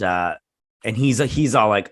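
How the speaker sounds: ordinary talk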